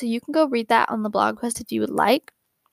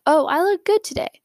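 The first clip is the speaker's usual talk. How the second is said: bright, higher "Oh, I look good today"; cheerful self-talk; 7 semitones higher than usual. Retold or imitated. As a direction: say it pleased with yourself. Contrasting self-talk quotes.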